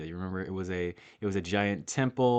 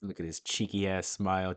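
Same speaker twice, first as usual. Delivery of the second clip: ordinary talk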